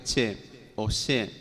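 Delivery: "che" then "she", said slowly and set apart; che and she demo. Shown as a demonstration. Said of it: "unida a la E, por ejemplo". ("che" y "she" pronunciados despacio, contrastando)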